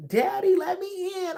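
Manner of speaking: put-on high, slow little-girl plea; little girl at the door. Little daughter begging her dad through the locked door, high and slow. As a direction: plead like a small child locked out. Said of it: "little girls gonna knock on the door talking about".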